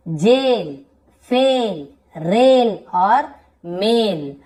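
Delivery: "जेल", "फेल", "रेल", "मेल" slowly with long ए; long ए vowel. Shown as a demonstration. Teacher modelling AI words, long ए in "मेल". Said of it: "थोड़ा लंबा ए".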